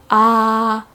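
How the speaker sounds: "ah" held long and level; flat tone. Shown as a demonstration. Teacher modelling tone one, "ah" held very flat. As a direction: keep "ah" on one steady, unchanging pitch. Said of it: "also called the flat tone".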